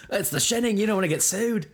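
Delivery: laughing, high-pitched mock Scottish accent. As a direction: do a bad Scottish groundskeeper bit. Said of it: "great Scottish accent by me".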